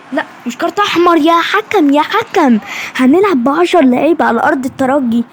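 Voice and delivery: loud angry shout at the referee; angry protest. Real, not acted. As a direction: yell your protest at the ref. Protesting red card to referee.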